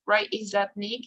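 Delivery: normal talking voice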